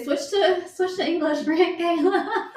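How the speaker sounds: put-on high, loud, slowed laughing call to the kids; a mom calling her kids with a laugh to switch languages; laughing mom's call. Friend quoted telling kids to switch.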